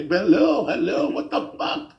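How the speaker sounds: high mock shout into the phone; exasperated caller. Frustrated caller to a phone agent, exasperated. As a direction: act out a caller at wit's end, voice jumping up.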